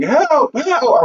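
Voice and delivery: high mock yell for help; full-throat yell, imitated. Lost soldier calling for someone to answer, imitated yelling. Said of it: "I'm full throat yelling".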